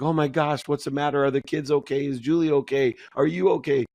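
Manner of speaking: high, anxious motherly questions; a frantic mother checking everyone is fine; worried mom. Retold or imitated. Mother fearing the worst as her son cries, high and alarmed. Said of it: "And my mom's like".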